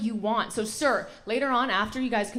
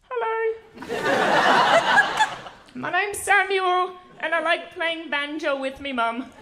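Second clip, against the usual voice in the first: put-on mild, higher, slow British accent, polite and sweet; a gentle British baker, introducing himself shyly; 6 semitones higher, 4 dB louder, slower than usual. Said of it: "every contestant on the Great British Baking Show is just like".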